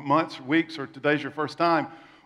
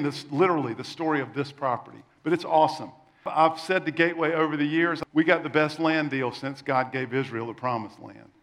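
normal talking voice

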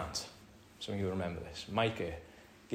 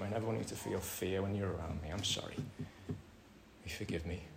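soft sincere apology; 9 dB quieter than usual. Retold or imitated. Uncle apologising to the boys.

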